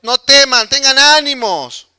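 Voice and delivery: Jesus shouting to his disciples to calm down, loud and high. Retold or imitated. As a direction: yell over the storm so they stop panicking. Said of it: "Jesús ahí gritándole también".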